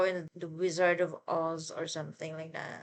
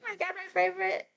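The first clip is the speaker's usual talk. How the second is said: fangirl squealing; 12 semitones higher, faster than usual. Real, not acted. Favorite character revealed.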